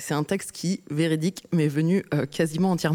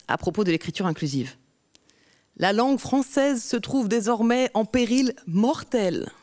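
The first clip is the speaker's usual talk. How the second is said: rhythmic spoken-word delivery; slam poetry; 5 semitones higher than usual. Acted. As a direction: perform it like a slam poem. Performs her slam poem.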